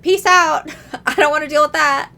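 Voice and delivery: high, loud, fast getaway line; panicked bail. Retold or imitated. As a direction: squeal it and run. Hoggle flees the monster roar.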